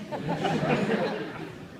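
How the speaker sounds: breathy burst of laughter; laughing. Real, not acted. Teller remembering the wrecked bike, laughing. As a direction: laugh helplessly at the memory. Laughing at his own story.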